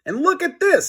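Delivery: very high excited voice. A narrator's reading. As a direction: squeak it out eagerly. Harold eager discovery.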